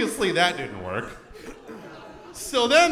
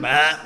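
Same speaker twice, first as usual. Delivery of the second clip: quick, loud gagging "bleh"; mock retch; 5 semitones lower, 5 dB louder than usual. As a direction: gag at the mere thought of food. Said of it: "the thought of food".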